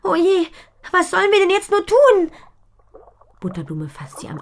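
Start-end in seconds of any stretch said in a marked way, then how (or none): 0.0-2.3 high and loud, hands over her face in despair; despairing girl. Acted. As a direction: wail it out, helpless. Mädchen verzweifelt am Brunnen. (verzweifelt, hoch und laut)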